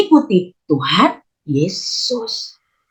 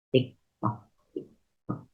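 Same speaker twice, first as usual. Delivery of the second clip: ticking clock; 9 semitones lower, 15 dB quieter than usual. Shown as a demonstration. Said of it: "quiz countdown clock noise".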